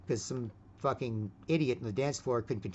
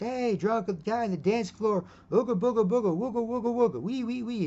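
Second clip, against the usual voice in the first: put-on high, silly drunk babbling; mock drunk guy; 9 semitones higher, 3 dB louder than usual. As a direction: ham up a sloppy drunk on the dance floor. Mocking the drunk dancer.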